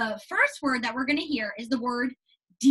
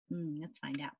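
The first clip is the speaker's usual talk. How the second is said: hushed; 8 semitones lower, 14 dB quieter than usual. Real, not acted. Hushed lead-in to reading.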